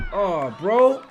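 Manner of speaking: loud disbelieving oh, bro; can't believe what he's seeing; loud stunned gasp at the replay; amazed. Real, not acted. Watching the finish again.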